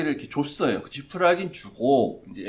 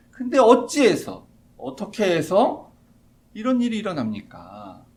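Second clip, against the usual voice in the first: lament; 5 semitones higher, 6 dB louder than usual. Retold or imitated. Moses questions God.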